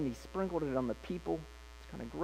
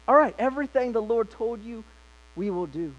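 eager pledge; 7 semitones higher, 5 dB louder than usual. Retold or imitated. People pledging eager obedience.

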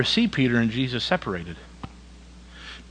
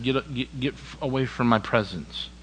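hushed Peter; 7 dB quieter than usual. Acted. Peter voiced softly.

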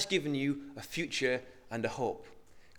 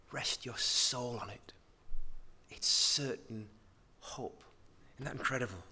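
whisper; 2 semitones lower, 6 dB quieter than usual. Real, not acted. Intimate sermon climax.

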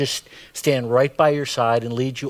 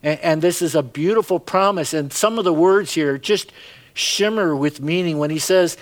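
ordinary talk, nothing marked

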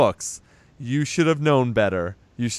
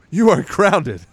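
higher, louder, mock-stern verdict; stern uncle; 7 semitones higher, 5 dB louder, slower than usual. Stein scolding his niece, mock-stern and loud. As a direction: lay down the law. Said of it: "And he's like".

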